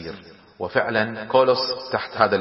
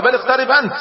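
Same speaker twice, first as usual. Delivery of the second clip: much higher, insistent retort; calling back; 12 semitones higher, 4 dB louder than usual. A narrator's reading. Roman commander refuses to approach Khalid, calling back high. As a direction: throw the demand back at him, high.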